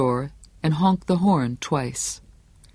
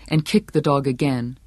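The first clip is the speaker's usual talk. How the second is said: ordinary delivery, nothing marked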